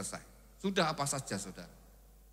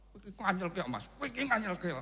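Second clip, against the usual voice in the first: sulky wife; 6 semitones higher, 3 dB quieter than usual. Acted. Wife sulking at husband.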